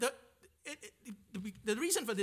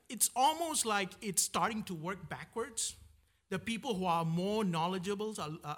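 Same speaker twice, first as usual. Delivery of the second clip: ordinary delivery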